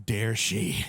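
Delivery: seething. Retold or imitated. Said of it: "And I would think".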